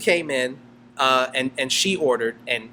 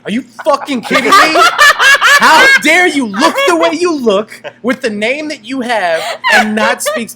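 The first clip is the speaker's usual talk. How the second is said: loud, high mock outrage; hammed-up scolding, pitched up; retold anger; 10 semitones higher, 8 dB louder than usual. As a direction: act out an indignant customer, half laughing. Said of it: "they would get angry at me".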